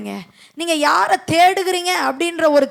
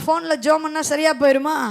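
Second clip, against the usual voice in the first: usual speaking voice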